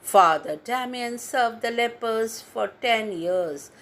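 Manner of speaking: usual speaking voice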